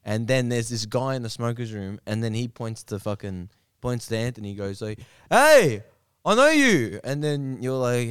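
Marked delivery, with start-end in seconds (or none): 5.3-7.0 high, loud excited hail; calling out in recognition. Retold or imitated. Smoker's room guy spotting a familiar face, calling out. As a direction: hail someone you think you know, pitched high. Stranger calls out recognizing him.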